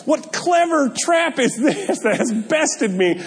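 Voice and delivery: high, slow, hammed-up theatrical cry; mock screaming monkey. Trapped monkey crying out at the hunter, hammy high shout. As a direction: play the trapped monkey, outraged and grand. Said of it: "it's like screaming".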